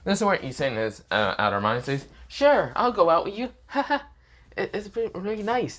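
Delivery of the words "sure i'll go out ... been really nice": cheerful tone. Acted. Issei fakes delight at the date offer, high and cheerful. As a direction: gush like a happy dupe. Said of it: "cheerful tone sounds nothing like him".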